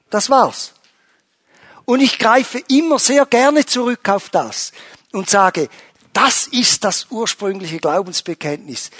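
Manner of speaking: high and loud, driving each claim home; impassioned preaching. Acted. Pastor rousing the congregation about Jesus as owner, impassioned preaching. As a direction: preach it out to the congregation with full force.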